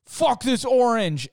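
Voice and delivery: high, loud, slow furious shout; retold actor yelling at a prop; angry yell. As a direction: hurl the line in a rage at the orange. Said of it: "And he's like".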